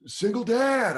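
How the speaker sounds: heckler yelling. Retold or imitated. Drunk fan steals the punchline, imitated yell. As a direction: blurt the punchline like a high-voiced heckler. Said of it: "is yelling out the punchlines".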